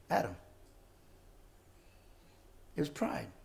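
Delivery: hushed. Real, not acted. Sermon drops to hush.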